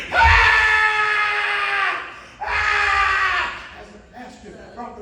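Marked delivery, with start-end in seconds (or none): 0.1-3.5 high, loud imitated scream; anguished scream. Driver after hitting his son, imitated loud screams. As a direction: scream out in horror. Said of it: "the bus driver screamed".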